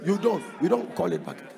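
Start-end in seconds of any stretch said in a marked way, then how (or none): none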